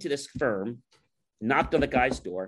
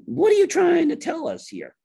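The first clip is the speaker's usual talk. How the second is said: very high, loud mock disbelief; incredulous demand; 7 dB louder than usual. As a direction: demand an answer in a raised, exasperated voice. Retold vendor confronts the departing client.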